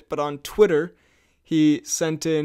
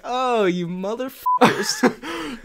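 higher, slow mock-angry outburst; Tom Cruise; 7 semitones higher, much slower than usual. Host imagines the actor spotting paparazzi, mock-furious. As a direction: draw out the curse in mock menace. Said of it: "Cruise looks like he's like".

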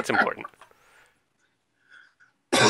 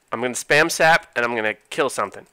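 normal talking voice

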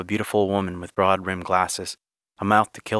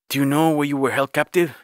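higher-pitched, insistent question; pressing questioning; 6 semitones higher, 4 dB louder than usual. Acted. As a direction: question them urgently, voice lifted. Johnny interrogates the migrants.

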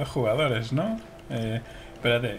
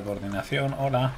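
normal talking voice

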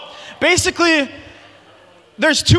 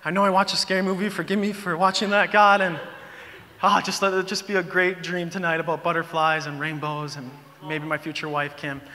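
soft, lower-pitched retold prayer; bedtime prayer; 5 semitones lower, 7 dB quieter than usual. Teen praying before sleep after a scary movie, retold quiet prayer. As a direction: pray it softly, trailing down quieter. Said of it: "I kinda say, like, my one prayer".

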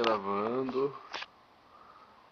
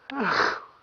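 sudden loud burst of laughter; 12 semitones higher, 10 dB louder than usual. Real, not acted. Drunk man laughs at himself.

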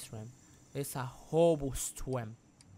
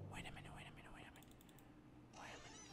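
breathy hushed whisper; 28 dB quieter, much faster than usual. Real, not acted. Gamer whispering while sneaking past the enemy. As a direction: whisper so the enemy won't hear.

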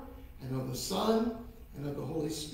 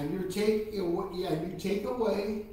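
sweet patient sunday school; 2 semitones higher than usual. Acted. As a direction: lead the kids in prayer phrase by phrase. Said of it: "repeat after me".

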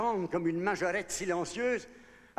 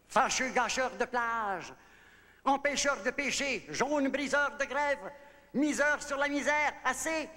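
high, thundering tirade of an angry giant; giant's anger; 5 semitones higher than usual. A narrator's reading. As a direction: hurl it out high, like a giant fed up. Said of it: "Et le géant se fâche". (colère de géant qui tonne)

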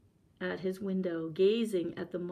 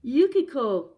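loud, high cry of her name; 6 semitones higher, 9 dB louder than usual. A narrator's reading. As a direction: call after wife in distress. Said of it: "Osamu cried".

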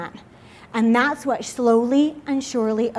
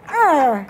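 laughing mock growl; 4 dB louder than usual. Mock angry God growling.

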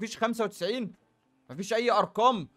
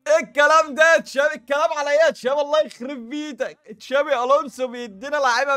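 amazed shouting; 7 semitones higher, 11 dB louder than usual. Real, not acted. Shocked by a big pack pull.

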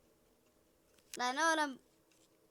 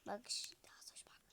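whisper; 6 semitones lower, 16 dB quieter than usual. Pretend. Whispered aside.

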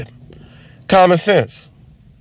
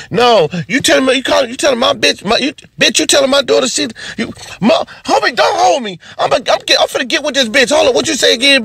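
angry mom; 11 semitones higher, faster than usual. Acted. Mother confronting waitress at Yard House, high furious. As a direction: go off on the waitress, ready to fight.

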